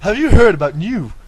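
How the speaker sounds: loud, bright infomercial pitch; cheesy advert voice. Acted. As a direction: sell it like a TV advert. Spoofs a TV advert.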